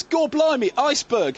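high, loud hammy Cockney; bad movie Cockney lookout; old london cockney. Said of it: "hopeless accent".

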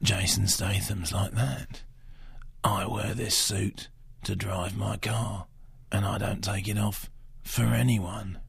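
low, very slow, soft gravel-free hard man; slow menacing London tough guy; Jason Statham impression. Critic showing his better impression, slow low Cockney. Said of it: "a better Jason Statham".

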